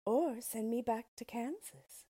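a hint of a hopeful young girl; Dorothy voice. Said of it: "said Dorothy".

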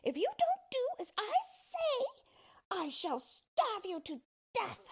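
very high, shrieking witch threat; shouting witch. Acted. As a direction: screech it like a frightened witch. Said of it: "shouted at him".

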